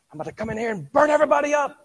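high, loud, fast mock threat; mock angry God. Preacher mocks God as raging, loud and fast. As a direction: ham up a furious God about to strike.

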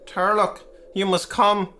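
panicked man out of breath after struggling up the hill; breathless panic. A narrator's reading. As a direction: blurt it out winded from the climb. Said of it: "out of breath and in a terrible state of panic".

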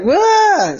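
shrill cartoon scream of fright; high panicked yelp. Oliver attacked by the player, high frightened wah. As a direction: yelp and run.

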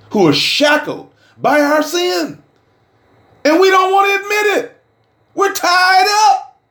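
a preacher hollering that the flock is tied up in sin; full-force preaching shout. Acted. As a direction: preach it out at the top of the voice. Preaching climax on bondage.

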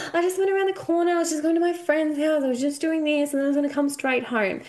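mock child voice, high and quick; imitating her son. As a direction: rattle off excuses like a guilty kid. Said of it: "And he went".

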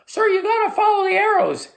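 very high-pitched young boy imitation; prepubescent teenage boy. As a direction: squeak like a boy before puberty. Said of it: "hasn't reached puberty in his voice".

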